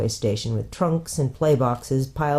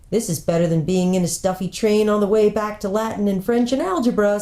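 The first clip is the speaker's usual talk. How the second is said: cheerful; 6 semitones higher than usual. Acted. Wading happily in the sea.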